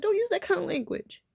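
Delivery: high, loud imitated reprimand; retold scolding. Man correcting the speaker's language, retold scolding.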